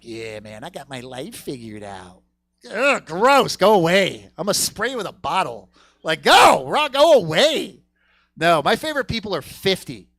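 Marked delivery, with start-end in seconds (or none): 0.0-2.2 put-on smug twenty-five-year-old. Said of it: "That's just like". 2.7-7.8 loud, higher shooing; mock disgust. Comic shooing off a twenty-five-year-old, loud.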